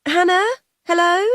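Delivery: impatient carer checking the line; high rising hello; calling the carer. Acted. Carer on the phone.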